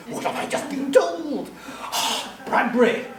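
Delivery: high, loud mock-dramatic hero voice; theatrical cry of discovery; Thirty-nine Steps hero. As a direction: ham up an astonished film hero's revelation. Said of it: "like Hannah in the Thirty-nine Steps".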